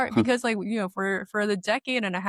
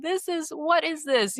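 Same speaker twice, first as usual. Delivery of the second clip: higher, puzzled voice; baffled; 8 semitones higher than usual. Retold or imitated. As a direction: sound thrown and confused. Athletes confused by yoga.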